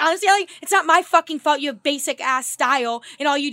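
loud, mock-indignant comeback; retold snapping insult. Mimicking friend attacking her style, indignant. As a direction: imitate a friend firing back an insult.

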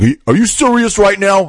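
high-pitched, pushed disbelief; incredulous challenge. Retold or imitated. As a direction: imitate a big cop in utter disbelief. Huge officer challenges storyteller's thanks.

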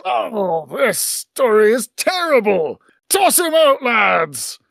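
captain snapping, rising on toss; captain rejecting a bad story to crew; disgusted pirate captain. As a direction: scoff and order him out.

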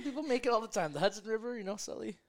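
excited. Real, not acted.

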